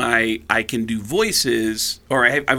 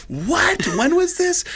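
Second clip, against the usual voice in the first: high, astonished questioning; acted-out astonishment; shocked disbelief; slower than usual. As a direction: react to wild gossip, voice leaping up. Acts out shock at gossip.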